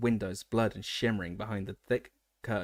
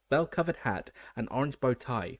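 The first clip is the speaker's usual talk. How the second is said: plain reading voice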